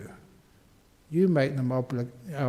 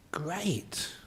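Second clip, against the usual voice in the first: whisper; 3 semitones higher, 10 dB quieter than usual. Acted. Aside on abundant energy.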